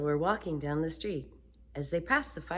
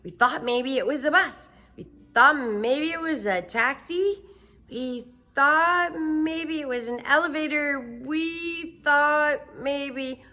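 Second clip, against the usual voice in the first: put-on high chatty girl, slowing at end; Sheila's voice; 11 semitones higher, 6 dB louder than usual. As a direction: innocent little girl explaining. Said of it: "Sheila said".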